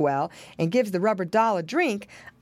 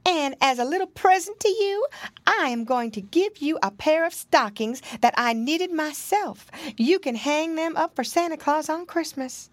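higher-pitched storybook animal lady; kindly, high character voice; kind muskrat nurse; 9 semitones higher than usual. A narrator's reading. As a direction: voice a muskrat nurse offering a gift. Muskrat offers a present.

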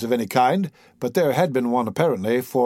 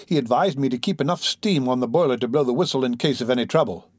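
ordinary delivery

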